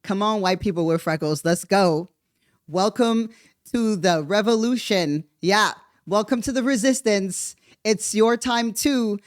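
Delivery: a tongue-in-cheek call to join the cause; mock rallying call. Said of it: "And I'm just like".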